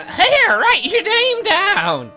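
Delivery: put-on high, loud, drawn-out girl voice; high-voiced game girl. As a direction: squeal it out like an excitable girl.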